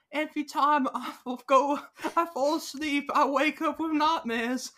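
high, mock British accent with a laugh creeping in; stupid British accent. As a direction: do a silly high-pitched British voice. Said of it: "they're doing a stupid accent".

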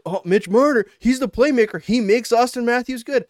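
mock-adoring fan rave; adoring fan gush. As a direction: swoon over the playmaker.

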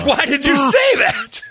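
high, loud, disbelieving yelp; shrieking laughing disbelief; shocked cry. Real, not acted. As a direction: cry out in horror. Laughing shock at pink eye.

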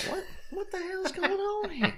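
a jokey high-pitched what-the-hell; mock surprise. Co-host hamming up mock alarm at the German. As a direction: play up fake bewilderment.

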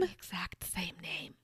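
breathy, ominous whispered confirmation; hushed echo of the shocking reveal. Acted. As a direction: whisper it like a spooky twist.

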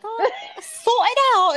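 laughing mock Londoner, loud and high; hammed-up London accent through laughter; imitated London accent. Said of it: "she's got a heavy Londoner accent".

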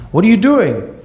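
high, sharp angry demand; angry mom. Retold or imitated. Mom confronts husband from floor.

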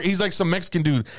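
ordinary talk, nothing marked

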